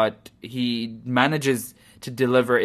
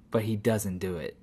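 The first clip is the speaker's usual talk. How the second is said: ordinary delivery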